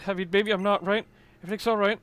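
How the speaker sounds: usual speaking voice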